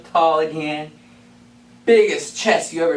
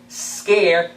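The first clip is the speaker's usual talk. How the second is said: normal talking voice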